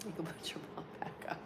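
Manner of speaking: fast soft whisper to a pet; whisper to cat. Real, not acted. Owner whispering to her cat Pumpkin, quick soft whisper. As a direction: hush it so only the cat hears.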